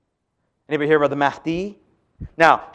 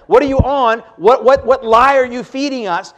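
higher, louder mock disbelief; a raised, scoffing what are you on; incredulous retort; 6 semitones higher, 5 dB louder than usual. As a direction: fire back as if it's absurd. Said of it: "I apologize".

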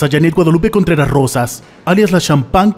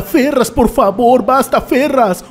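higher, drawn-out begging; slow mock plea; 8 semitones higher, slower than usual. Victim begging Ferraz slowly to stop. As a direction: plead slowly with him.

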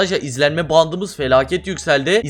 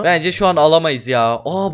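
ordinary talk

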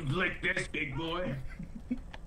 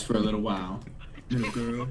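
creepy deep voice; 6 semitones lower than usual. Acted. Creepy monster taunt.

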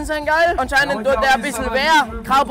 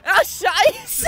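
high shout "Ah, scheiße"; mock dismayed shout; 9 semitones higher than usual. Real, not acted.

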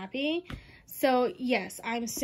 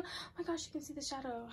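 much softer, almost hushed; awed hush over the box; 13 dB quieter, faster than usual. Real, not acted. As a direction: drop to a hush in wonder.

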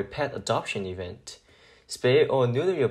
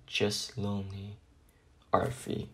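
much softer, sinking into a whisper; lonely; 4 semitones lower, 9 dB quieter than usual. Acted. Arfie admitting he is lonely, fading to a whisper. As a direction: let the words fade out in loneliness. Lonely dog signs his letter.

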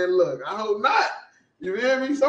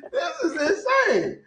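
laughing disbelief; 12 semitones higher, slower than usual. Real, not acted.

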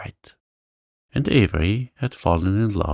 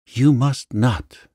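louder, slow, desperate plea; crying wildly; 5 semitones higher, 5 dB louder, slower than usual. A narrator's reading. Janet begs Avery not to marry, loud slow desperate cry. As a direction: cry it out wildly to stop her. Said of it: "she cried wildly".

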